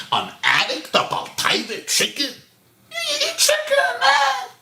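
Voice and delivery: incredulous scolding building to a high squeal; mock self-reproach. Husband telling himself off over a chicken, mock self-reproach. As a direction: talk himself down, ending in an absurd high cry.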